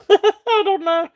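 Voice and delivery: high laughing words. Real, not acted. Seller laughing as she tries the word again. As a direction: talk through the giggles.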